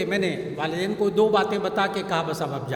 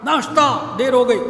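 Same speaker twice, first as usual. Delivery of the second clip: loud, higher mock shout of the impatient father; retold shout; 4 semitones higher, 9 dB louder than usual. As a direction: bark it out like an angry husband demanding breakfast. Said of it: "جس دن وہ چیخ کر کہتا تھا".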